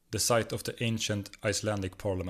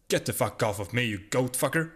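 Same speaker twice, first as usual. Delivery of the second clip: screamed; 3 semitones higher than usual. A narrator's reading. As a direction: read the insult as a half-hearted yell. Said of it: "he screamed".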